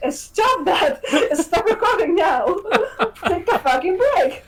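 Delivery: high, laughing mock command; exasperated order through laughter. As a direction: plead with him to stop, cracking up. Retold pleading with overworked actor.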